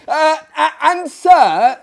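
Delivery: high, loud shout over the din; screaming. Acted. Narrator screaming into the host's ear over the noise. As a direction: yell to be heard over music. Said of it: "screaming in his ear".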